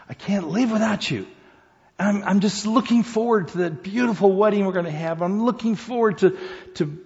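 doting woman, a sweet high put-on voice; Susan's voice. Woman gushing to her new fiancé in a high sweet voice.

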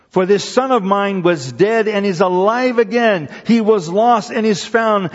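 loud, high, triumphant announcement; joyful proclaiming. Acted. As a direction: proclaim it with overflowing joy. Father rejoices over lost son.

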